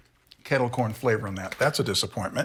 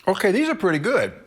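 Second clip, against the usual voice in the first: bright, high and pleased; happy verdict on the candy; 6 semitones higher than usual. Real, not acted.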